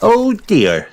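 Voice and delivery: put-on high little-girl dismay; Peppa. A narrator's reading. Child seeing dad's splashed glasses, high dismayed voice. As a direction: pitch it up, concerned.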